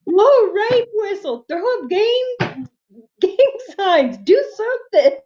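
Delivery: high, urgent mock pleading; exasperated pleading. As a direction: beg the cat to do anything. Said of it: "He tells him".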